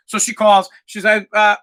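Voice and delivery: ordinary delivery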